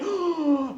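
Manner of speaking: sharp audible intake of breath; mock creeped-out gasp. Class looking at a creepy picture, mock gasp.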